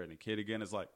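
ordinary talk

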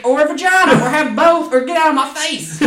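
high, loud mock grumbling; cranky old-timer rant. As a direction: holler like a fed-up old-timer. Playing cranky old-timer ranting about pronouns.

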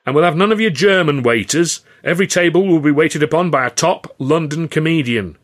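loud, booming impresario selling a vision; grand showman Carno. Acted. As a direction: proclaim it like a proud showman to his company. Carno teases the comedians.

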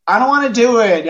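put-on high whiny refusal, a touch childish; sulky kid who won't budge. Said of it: "the father knows it's just".

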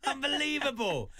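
high, loud, laughing astonishment; amazed exclamation. Real, not acted. Host marvels at the letter's coincidence, loud amazed exclamation. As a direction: cry out in delighted disbelief.

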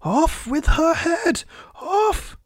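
very high, slow royal shriek; screamed fury. A narrator's reading. Queen condemns Alice, high furious scream. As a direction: screech the order in fury, drawn out.

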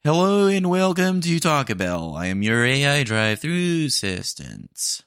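high, bright robotic greeting; AI drive-thru voice. Acted. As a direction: play a chipper drive-thru bot. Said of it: "Let me get into character".

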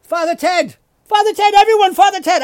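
mock game show host yelling; excited host. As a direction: hype the crowd up. Host excitedly announces answer.

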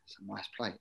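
whispered under the breath, almost silent; hushed aside while eyeing the plate; sneaky whisper. Retold or imitated. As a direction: slip the line out in a secret whisper. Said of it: "I said".

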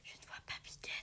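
hushed whisper so the chicks sleep; whisper, don't wake the babies. A narrator's reading. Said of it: "dit Heidi en chuchotant". (Heidi chuchote pour ne pas réveiller les petits aiglons)

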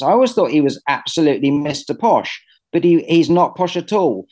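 usual speaking voice

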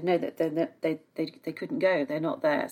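ordinary talk, nothing marked

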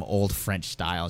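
normal talking voice

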